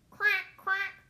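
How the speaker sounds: loud, high quacks; storybook duck call. Acted. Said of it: "called the duck".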